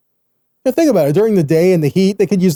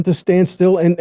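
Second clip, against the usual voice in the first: usual speaking voice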